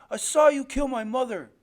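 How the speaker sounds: put-on high, loud child's accusation; defiant accusation. Boy tells his father he saw it, defiant cry.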